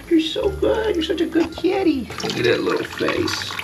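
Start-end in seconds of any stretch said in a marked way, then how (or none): 0.1-2.0 much higher, gushing pet praise. Real, not acted. Man praising the stray cat for sitting still, high cooing. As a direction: gush over the good kitty.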